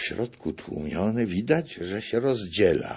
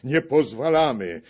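higher and louder, stress on Nie; loud protest; 7 semitones higher, 7 dB louder than usual. A narrator's reading. The foreign gentry cry their veto, loud. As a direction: bellow the veto. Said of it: "woła w głos".

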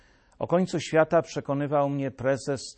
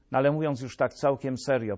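usual speaking voice

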